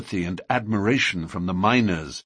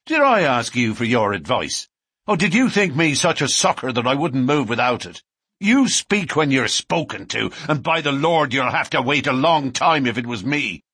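loud, raised and scornful; angry; 5 semitones higher, 5 dB louder than usual. A narrator's reading. McMurdo rounds on the patrolman, loud and contemptuous. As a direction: keep berating him at full volume. McMurdo keeps berating the policeman.

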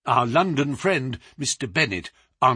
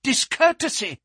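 high-pitched pleading protest; alarmed secretary's appeal, voice raised high; urgent cry; 13 semitones higher than usual. A narrator's reading. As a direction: plead with him to stop. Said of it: "he cried".

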